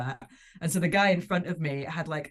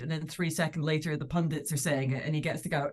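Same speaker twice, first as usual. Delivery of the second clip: ordinary talk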